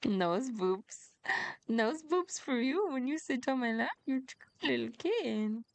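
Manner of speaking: high, playful cooing to a kitten; baby talk to pet. Pretend. Streamer promising a kitten nose boops, cooing playfully.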